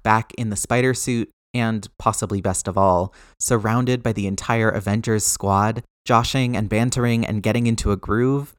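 ordinary delivery, nothing marked

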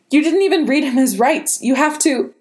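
high, urgent frightened plea; desperate aunt, pitched high with alarm; alarmed protest. A narrator's reading. Aunt protests nephew's arrest.

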